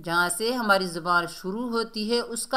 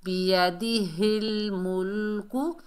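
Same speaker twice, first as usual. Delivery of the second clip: slow, drawn-out syllables on steady pitch; measured recitation; slower than usual. Shown as a demonstration. Teacher models the verse for learners, slow recitation. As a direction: recite slowly, holding each long sound.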